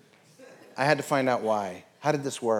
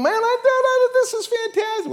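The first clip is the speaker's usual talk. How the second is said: very high, loud gushing; hammed-up excitement; 4 dB louder than usual. Wife coming home with good news, hammed-up excitement. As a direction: squeal it in an exaggerated high voice.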